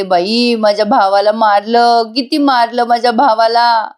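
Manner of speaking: normal talking voice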